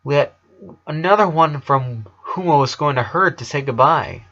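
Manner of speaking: ordinary delivery, nothing marked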